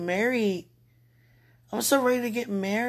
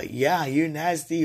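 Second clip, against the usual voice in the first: slightly lower, slow mock pronouncement; imagined God's verdict; 5 semitones lower, slower than usual. Woman imagines God answering her worry, low mock verdict.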